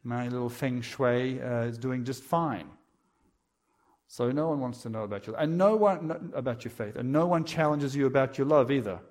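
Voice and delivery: normal talking voice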